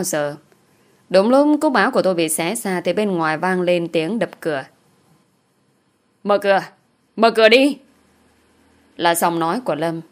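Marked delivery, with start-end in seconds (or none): 6.2-7.8 rising, louder urgent demand; calling through door. A narrator's reading. Man pounding on the door from outside, calling out.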